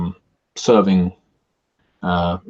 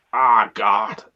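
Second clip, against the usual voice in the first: groaning in pain; 3 semitones higher, slower than usual. Acted.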